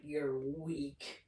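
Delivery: lightly voiced villain growl, low and soft; a hint of a menacing Goblin taunt; quietly imitating a sneering supervillain. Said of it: "doing that awesome Norman voice, or, uh, Goblin voice".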